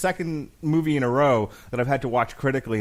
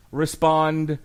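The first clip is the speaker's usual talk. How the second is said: ordinary talk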